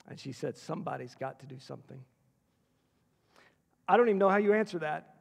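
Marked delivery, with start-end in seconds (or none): 0.6-2.0 low, soft, understated grief; hushed, sorrowful appeal; retold sobbing plea. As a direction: quote a grieving plea very quietly. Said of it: "I mean just sobbing".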